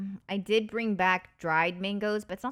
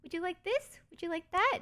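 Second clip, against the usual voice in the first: high eager offering voice, lifted way up; high-pitched doting host impression; sweet hosts; 9 semitones higher than usual. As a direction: mimic the sweet, fussing hosts. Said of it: "They're like".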